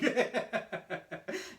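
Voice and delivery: put-on high giggling like a little kid; imitated giggle. Host imitating Mister T, high giggle. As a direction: giggle like a child.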